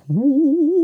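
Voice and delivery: high mock ghostly woo; imitating spooky podcast intro. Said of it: "They're like".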